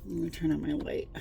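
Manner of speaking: normal talking voice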